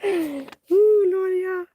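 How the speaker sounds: high, slow, crying with laughter; laughing. Real, not acted. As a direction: wail it out through real laughter. Cracking up after bear bit.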